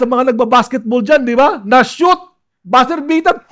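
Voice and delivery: high, loud pulpit exhortation; fervent, emphatic preaching; full-force preaching. Real, not acted.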